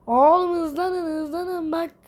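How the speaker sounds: high, slow, drawn-out hurry-up; urgent urging. Real, not acted. Gamer urging little game characters to hurry, high drawn-out urging. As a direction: egg them on, stretching the words.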